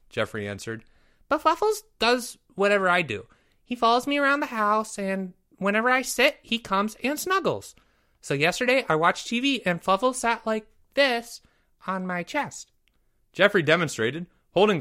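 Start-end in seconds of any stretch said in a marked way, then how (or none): 1.3-12.7 put-on high eager little boy; young boy voice. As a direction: talk like a proud kid. Jeffrey talks about his kitten.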